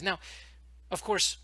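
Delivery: normal talking voice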